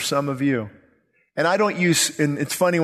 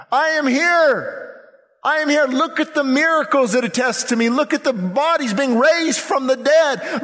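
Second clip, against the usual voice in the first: crying plea; 9 semitones higher than usual. Retold or imitated. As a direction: plead passionately as the rejected Messiah, without real tears. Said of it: "He's crying for Jerusalem, saying".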